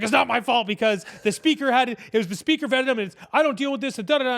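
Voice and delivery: hammed-up frantic excuse-making; frantic Trudeau. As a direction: rattle off excuses in a mock panic. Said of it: "freaking out, looked super frantic".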